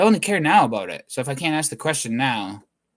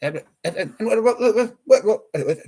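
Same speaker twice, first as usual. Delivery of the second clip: laughing stuck uh then higher, louder what; mock Elon Musk-style stutter, laughing; imitating stutter; 4 semitones higher, 3 dB louder than usual. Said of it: "you hear me".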